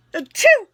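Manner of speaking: sharp high sneeze; real sneeze bursting out; sudden sneeze; loud sneeze. Real, not acted. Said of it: "excuse me".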